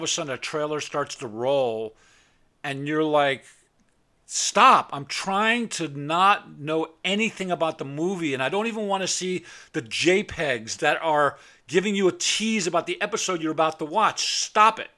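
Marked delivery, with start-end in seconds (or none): 4.3-4.9 sharp loud stop; shouted stop. Retold or imitated. Annoyed at autoplay trailer. 14.4-14.9 loud exasperated command; loud stop it. Real, not acted. Ranting about spoilers.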